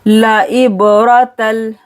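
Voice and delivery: ला इबरतल slow, with a bounced b; chanted ला इबरतल, b released with a bounce; qalqala on ba. Shown as a demonstration. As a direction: echo the b of इबरतल, throaty opening vowel. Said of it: "बा पर कलकला करना है".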